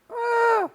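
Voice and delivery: sudden high shriek; startled scream. Real, not acted. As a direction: scream at the jump scare. Jump scare in horror game. (cri de peur)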